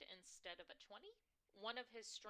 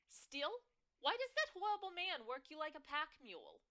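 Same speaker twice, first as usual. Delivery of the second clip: high, loud, scandalized New York mom; outraged on her daughter's behalf; indignant New York mother; 9 semitones higher, 12 dB louder than usual. Acted. Mother complaining about the boss, loud and shrill. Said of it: "My mother's shrill, New York-tinged voice".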